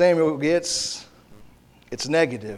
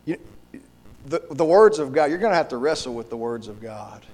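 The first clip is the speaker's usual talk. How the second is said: ordinary talk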